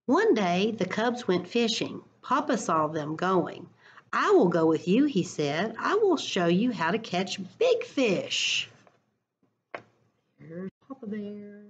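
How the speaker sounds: expressive picture-book read-aloud; reading to children. Acted. Teacher reading a picture book to her class, lively storytime voice.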